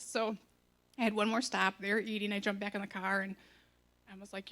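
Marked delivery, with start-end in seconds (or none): none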